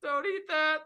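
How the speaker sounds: high, small voice from the darkness; a high voice drifting up from below; soft distant voice. Acted. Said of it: "you hear a soft voice echo".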